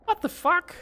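high, startled "What the fuck?"; shocked. Real, not acted. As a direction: yelp it in shock. Sudden death in game.

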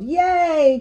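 high cheer, moderately loud; Elmo yelling. A narrator's reading. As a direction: join in cheering a friend on. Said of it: "and I yelled".

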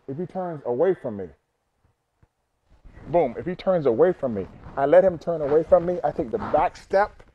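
ordinary talk, nothing marked

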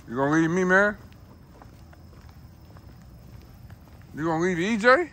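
loud high plea to the dog; calling a dog. Real, not acted. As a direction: call after a dog that runs off. Dog running off.

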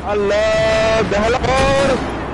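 high, slow words through laughter; laughing. Real, not acted. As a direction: laugh it out, delighted. Laughing at game voice line.

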